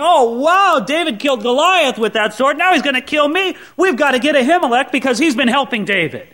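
suddenly scared he's next; panicked king. Acted. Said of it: "And Saul's thinking".

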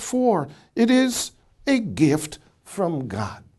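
measured, emphatic Bible reading; scripture reading. Acted. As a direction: read each phrase out firmly with pauses between. Reads scripture aloud to audience.

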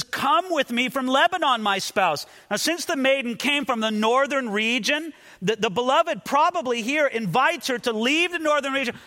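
fervent preaching. Acted. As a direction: plead the groom's call with full conviction. Preaches the beloved's invitation fervently.

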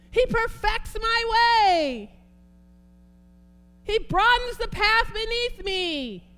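excited preacher yelling scripture; yelling with excitement. Real, not acted. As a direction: shout the faith out to them. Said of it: "I don't really mean to yell at y'all".

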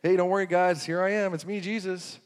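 light, chatty mock reassurance; casual reassurance. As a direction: toss it off like a casual hello to friends. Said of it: "he wasn't just saying".

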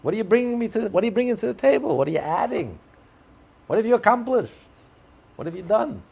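high, loud, insistent questions; voicing God, high and loud, pressing for an answer; demanding God. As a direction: press them like an exasperated God who wants results.

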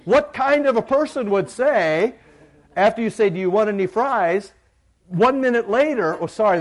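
very high, loud pulpit tirade; full-force preaching rant. Acted. Pastor ranting to congregation about food sharers, full-force preaching. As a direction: belt it out to the back pews, exasperated.

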